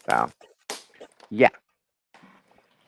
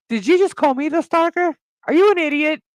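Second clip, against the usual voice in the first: indignant yell; 13 semitones higher, 4 dB louder than usual. Retold or imitated. Yelling back at neighbor calling her stalker.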